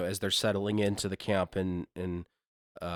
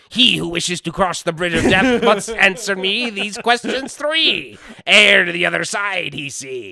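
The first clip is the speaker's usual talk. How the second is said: put-on high, slow, booming old keeper; bridgekeeper voice; 9 semitones higher, 8 dB louder than usual. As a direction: call it out like the Monty Python bridgekeeper. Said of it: "the only thing that I can think of".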